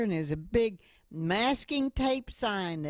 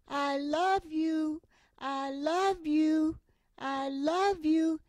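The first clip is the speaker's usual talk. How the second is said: slow, higher call across the canyon; loving call; 10 semitones higher, slower than usual. Retold or imitated. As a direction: call out love slowly to the boy across the valley. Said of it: "you yell to the little boy".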